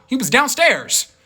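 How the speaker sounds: ordinary talk, nothing marked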